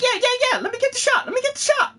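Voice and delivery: very high, eager Prompto squeal. Retold or imitated. Actor in Prompto's voice hurrying to get the photo, very high and eager. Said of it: "he's just like".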